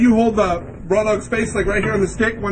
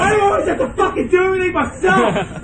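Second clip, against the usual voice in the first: exasperated shout; 6 semitones higher than usual. Acted. Mock exasperated yelling at friends.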